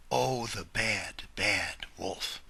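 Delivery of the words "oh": usual speaking voice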